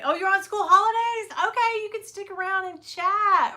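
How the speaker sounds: high, bright reaction to a comment; surprised and delighted. Real, not acted. Vlogger reacting to a viewer on school holidays, delighted.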